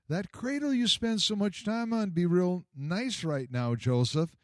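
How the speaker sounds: a hint of a gentle young wife; Mary's voice. Mary voiced speaking to Joseph.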